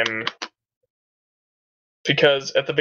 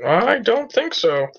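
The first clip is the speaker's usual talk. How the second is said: high coy denial; 9 semitones higher, slower than usual. Acted. Denying to thugs.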